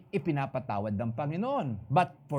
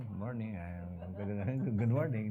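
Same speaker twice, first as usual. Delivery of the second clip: low greeting; 7 semitones lower, 6 dB quieter than usual. Retold or imitated. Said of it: "Sabi ko ganun".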